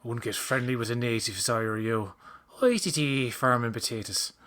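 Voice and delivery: light Irish accent impression; a playful Irish accent. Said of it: "when I do Irish accents".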